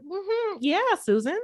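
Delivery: a hint of a careful office voice for Susan; code-switched work voice. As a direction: switch into a lightly voiced work persona for a coworker. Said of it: "But when I go to work".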